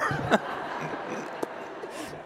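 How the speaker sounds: breathy rising chuckle at his own joke; short laugh. Real, not acted. Priest laughs at his own joke to the church, a short rising chuckle. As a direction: laugh it off lightly.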